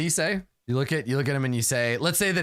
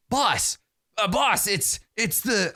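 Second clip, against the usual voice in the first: higher-pitched bugbear calling the boss; hesitant bugbear, pitched up, breaking off; 7 semitones higher, slower than usual. Acted. Bugbear reporting to his spider-faced boss, higher and halting. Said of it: "I forgot bugbears are Cockney".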